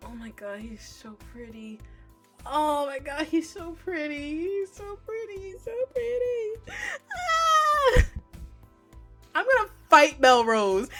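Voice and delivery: ordinary delivery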